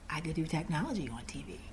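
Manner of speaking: low, quick, very soft; hushed epiphany. Retold or imitated. Applicant realising her calling, hushed epiphany. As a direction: breathe it to yourself as it dawns. Epiphany retold after long pause.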